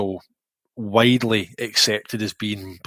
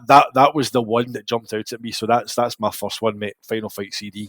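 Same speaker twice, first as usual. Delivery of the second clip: ordinary talk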